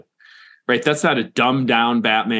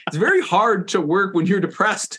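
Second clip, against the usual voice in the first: mock mournful storyteller; 8 semitones higher than usual. Mock tragic pigeon story.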